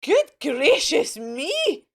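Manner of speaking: loud, slow gasp of shock; startled outburst; shocked exclamation. Acted. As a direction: exclaim it aghast. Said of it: "and exclaimed".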